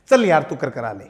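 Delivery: loud, irritated brush-off; retold cross dismissal; imitated exasperated anger. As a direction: wave the student away as a fed-up teacher. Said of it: "गुस्से में आ गया".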